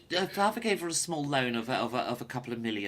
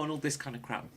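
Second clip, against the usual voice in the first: ordinary talk, nothing marked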